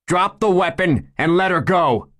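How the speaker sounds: high, loud, ringing command; order. Acted. Police chief ordering the gunman to drop the weapon, loud command. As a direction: order him to drop it, no room for argument. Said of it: "Nate ordered".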